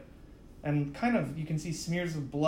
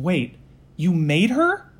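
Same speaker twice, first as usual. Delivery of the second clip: louder, rising shock on "made her"; sudden realization; 3 semitones higher, 8 dB louder, slower than usual. Acted. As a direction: catch it mid-thought, then rise. Malachi suddenly realizes something.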